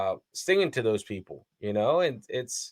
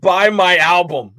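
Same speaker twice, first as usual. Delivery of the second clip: loud mock pitch; 6 semitones higher, 11 dB louder than usual. Joking what he should've said.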